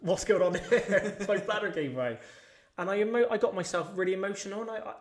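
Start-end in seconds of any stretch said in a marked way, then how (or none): 0.0-1.7 laughing disbelief. Retold or imitated. Remembering wetting himself while bench pressing, laughing. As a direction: laugh through the confusion. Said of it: "I'm in the garage of my own thinking".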